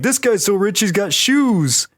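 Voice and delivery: high, awed cartoon exclamation; wide-eyed, over-impressed mimicry; amazed Patrick. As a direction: marvel in a high voice, stretching the last words.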